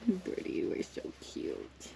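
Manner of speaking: soft whispered cooing. Real, not acted. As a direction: whisper sweetly to the little goat. Cooing at the goat.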